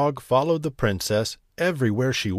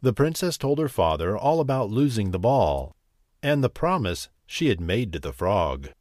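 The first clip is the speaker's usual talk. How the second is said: ordinary delivery, nothing marked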